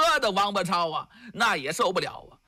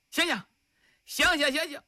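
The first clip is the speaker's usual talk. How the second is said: high, raised calls of wake up; urgent high calls to a fainted man; calling out to wake him; 7 semitones higher, faster than usual. Acted. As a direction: shake him awake with your voice. Rousing the unconscious captive.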